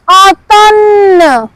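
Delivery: "automne" drawn out slowly; slow model. Shown as a demonstration. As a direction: hold the end of "automne" for learners. Teacher models French word slowly again.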